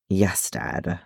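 subdued, put-upon obedience; youthful sulky compliance. A narrator's reading. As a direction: give in like a chided lad. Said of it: "in that tone of voice, I half believe you are a lad of sixty".

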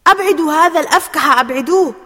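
high, very loud outraged order; indignant shout. A narrator's reading. Faisal ordering Ali off after own goal, indignant shout. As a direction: yell it out in fury. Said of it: "صاح فيصل بسخط".

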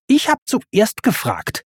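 high, sulky retort; petulant, high-pitched complaint; Timon grumbling. A narrator's reading. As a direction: grumble like a sulking child. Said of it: "maulte Timon".

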